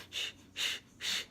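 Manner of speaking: short voiceless puffs of air; imitated hyperventilating. Husband panicking by the hospital bed, imitated hyperventilating. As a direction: mimic panicky breathing in quick bursts. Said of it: "like, hyperventilating or something".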